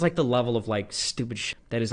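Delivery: normal talking voice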